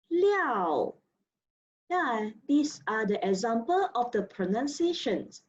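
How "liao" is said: "liao." falling sharply, fourth tone. Shown as a demonstration. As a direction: drop "liao." from high. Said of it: "Line number sixteen".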